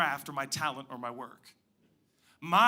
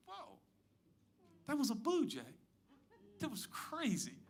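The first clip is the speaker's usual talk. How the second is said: surprised; 10 semitones higher, 9 dB quieter than usual. Pretend. Startled by a blue jay.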